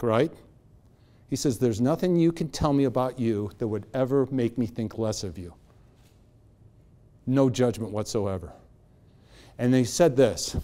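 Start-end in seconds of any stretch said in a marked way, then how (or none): 1.6-8.5 low, soft, sincere reassurance; James Hadlock. Retold or imitated. Man reassuring Bob there is no judgment, calm and authentic. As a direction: quietly promise full acceptance. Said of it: "he's being authentic".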